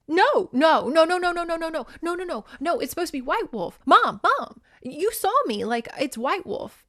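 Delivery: high, rapid-fire string of frantic no's; mock panicked protest. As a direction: protest in exaggerated high-pitched alarm. Daughter protests arranged match in retold scene.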